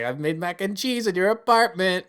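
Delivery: high-pitched hammed-up Russian accent; terrible Russian accent. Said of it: "that's a terrible Russian accent".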